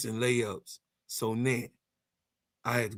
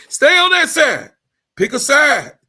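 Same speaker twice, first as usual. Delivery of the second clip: shouting the command with a laugh; loud rant; 12 semitones higher, 11 dB louder than usual. Real, not acted. Ranting vlogger shouts at Caitlin Clark fans. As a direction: yell it at the fans, half laughing.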